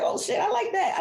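high, flattered exclamation; light up at the compliment, pitched high; delighted reaction. Retold or imitated. Mother hearing she is gorgeous, high playful delight.

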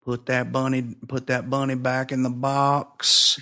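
put-on half-hearted, quiet Southern accent; bad Southern accent. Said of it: "my bad Southern accent".

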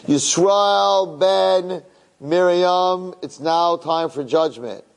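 high, very slow, booming accuser's proclamation; Satan as prosecutor. Acted. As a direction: announce the judgment like a cosmic prosecutor. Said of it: "and the Satan gets up and says".

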